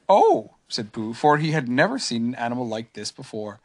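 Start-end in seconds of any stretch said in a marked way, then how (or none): 0.1-0.5 small gentle bear. A narrator's reading. Pooh voiced.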